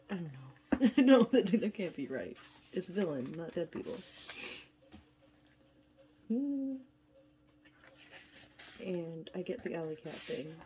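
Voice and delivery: usual speaking voice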